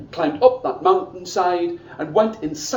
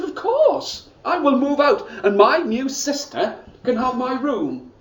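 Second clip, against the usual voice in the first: put-on much higher, bright willing girl's voice; eager daughter; 6 semitones higher than usual. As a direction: sing out a happy yes to father. Ye Xian cheerfully agrees, much higher.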